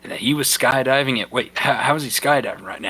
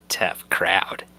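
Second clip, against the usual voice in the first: sinister; 6 semitones lower than usual. Acted. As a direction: say it like a cold threat. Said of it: "in the most sinister way possible".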